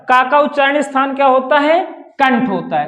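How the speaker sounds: ordinary talk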